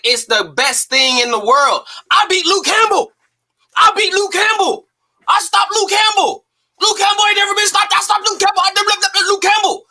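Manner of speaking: hammed-up high brag of a young fighter; mocking Ryan's boast. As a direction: crow like a cocky kid bragging over and over. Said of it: "you gonna sit up there and say".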